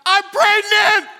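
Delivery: high, slow hammed-up shout; loud, high mock-triumphant cry; blurted announcement. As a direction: belt out the big news. Acted Mary blurts out news.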